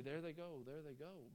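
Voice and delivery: soft, fading mourner's sigh of a farewell; grieving farewell. Pretend. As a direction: let the words sink away as if watching a boat leave. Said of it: "shore grieve and say".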